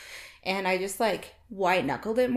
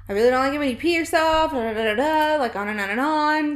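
mock lecturing rant; 5 semitones higher, 6 dB louder than usual. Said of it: "the lecturing".

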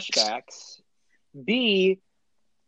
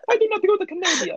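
mocking echo; faster than usual. Mocking a friend's line.

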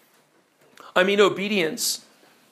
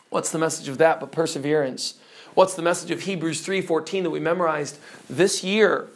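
ordinary talk, nothing marked

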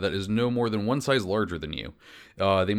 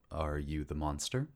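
soft and low, in character; low, quiet question; 5 semitones lower, 9 dB quieter than usual. Acted. Hunter confronting an old woman in her hut, low and quiet. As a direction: ask it softly, pitched down. Player speaks as Tenna to woman.

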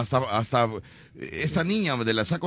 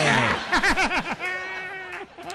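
high-pitched laugh. Real, not acted. Carcajada aguda. (carcajada aguda y estridente)

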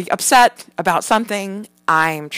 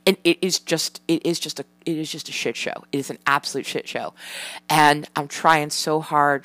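usual speaking voice

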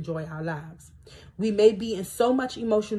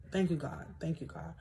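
quiet thanks; 3 semitones lower, 12 dB quieter than usual. Real, not acted.

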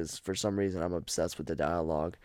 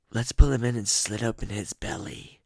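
raspier, a touch higher twin threat; raspy twin voice; 4 semitones higher, 3 dB louder than usual. Retold or imitated. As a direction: rasp it out like the rougher twin. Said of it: "I don't know which one said that".

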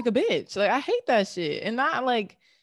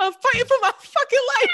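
high squealing laugh-talk; gasping through laughter; laughing hard; 10 dB louder than usual. Real, not acted. Laughing hard mid story.

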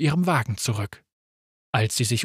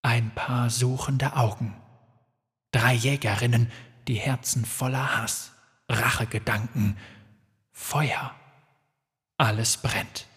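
eerie vision voice; 3 semitones lower, 6 dB quieter than usual. Acted. Muramat's vision.

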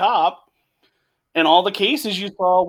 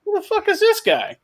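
put-on baffled kid. Kid's baffled reaction to Seagal.

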